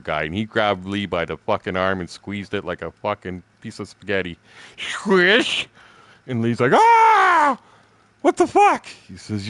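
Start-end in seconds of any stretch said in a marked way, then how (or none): 4.8-5.7 high, loud squish noise; squish sound effect. Retold or imitated. Describing the squeezed arm, a loud mock squish. 6.7-8.9 very high, loud mock cry of pain; pained yelp. Lee's arm squeezed by the bodybuilder, a high pained yell.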